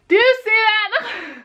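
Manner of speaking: high, loud and slow; disbelief. Real, not acted. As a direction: drag it out in outrage. Shade mismatch disbelief.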